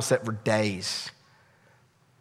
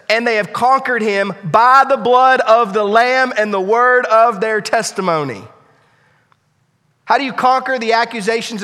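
loud, high proclamation; preaching at full force; 9 semitones higher, 8 dB louder than usual. Acted. Preacher answers Satan's accusations, loud and high. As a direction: thunder out the victory. Quoting scripture, then proclaiming victory.

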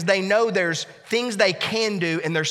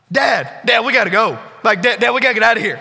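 panicked; 3 semitones higher, 6 dB louder, faster than usual. Retold or imitated. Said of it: "he is panicked".

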